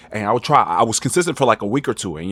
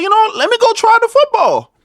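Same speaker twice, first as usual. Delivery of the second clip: much higher, louder put-on cartoon voice; goofy high self-voice; 7 dB louder than usual. Teen self deciding on football, high loud goofy voice. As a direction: ham up your younger self's bright idea. Voicing own decision, put-on voice.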